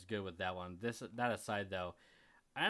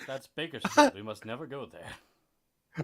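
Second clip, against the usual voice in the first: mock Mufasa voice; 2 semitones higher, 9 dB louder, slower than usual. Mufasa parody answer.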